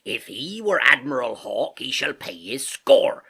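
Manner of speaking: loud, slow seafarer's declaration; Silver crying out. A narrator's reading. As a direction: thunder it out like an indignant landlord. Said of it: "cried Silver".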